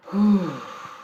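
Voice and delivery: long loud calming exhale; deep sigh. Real, not acted. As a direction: breathe out slowly and deeply. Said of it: "take deep breaths in".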